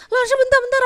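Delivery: high, loud, urgent interruption; hammed-up panicky call to the teacher; frantic mock plea. Student re-enacting a plea to her teacher, mock frantic. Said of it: "kayak orang gila gitu".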